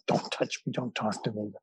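soft whispered brush-off; leave me alone. Retold or imitated. Sweaty commuter on a hot Manhattan day, whispered brush-off. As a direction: whisper it like an irritated stranger on edge.

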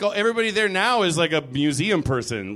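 ordinary delivery

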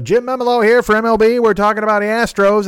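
high, loud sports radio announcer; radio host voice. Retold or imitated.

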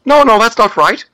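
very high, strained impersonation voice; Jordan Peterson impression. Shown as a demonstration. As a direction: crank the voice up high to mimic him. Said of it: "his voice goes up".

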